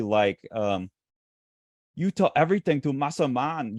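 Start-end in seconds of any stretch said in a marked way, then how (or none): 1.9-3.8 high, exaggerated mock Chinese accent; imitating a comic-book Chinese accent; caricatured Chinese accent from the manga. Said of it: "offensive Chinese accent".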